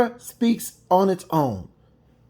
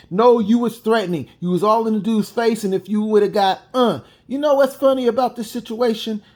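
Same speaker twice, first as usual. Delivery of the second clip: normal talking voice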